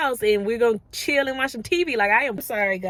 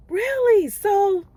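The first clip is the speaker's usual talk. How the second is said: incredulous really; 10 semitones higher, 4 dB louder, much slower than usual. Real, not acted. Mother stunned by son's words.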